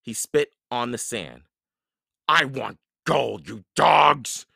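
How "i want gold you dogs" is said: loud, raised pirate bellow; angry Captain Bones. A narrator's reading. Captain Bones rages at his men over gold, bellowing. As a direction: bellow the demand, loudest at the end.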